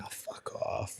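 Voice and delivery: whispered dismissive curse; a whispered fuck off; whispered retort. Retold or imitated. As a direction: breathe it out as a whisper. Retold reply to coach.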